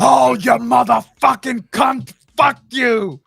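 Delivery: slow, loud, raging curse; imitating a friend's rage, shouted slowly; angry yell. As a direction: bellow it like a furious friend.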